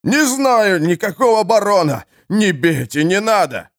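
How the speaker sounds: высокий вопль в ужасе; завопить от боли и страха; истошный вопль. A narrator's reading. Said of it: "Тот истошно завопил". (избитый тюремщик вопит под ударом молотка)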